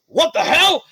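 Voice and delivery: shrill startled yelp; what the hell. Acted. Confused enemy caught off guard. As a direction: jump with a high shocked cry. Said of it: "he goes like".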